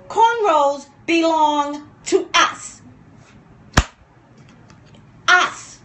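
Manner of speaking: loud, high and slow, worked up; real yelling. Real, not acted. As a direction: yell it out slowly, punching each word. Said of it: "I'm yelling lately".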